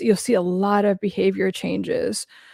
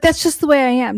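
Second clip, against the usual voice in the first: higher, louder snooty mimicry of Anna; mocking Anna; 4 semitones higher, 4 dB louder than usual. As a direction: parrot her smug excuse, mocking. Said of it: "She's like".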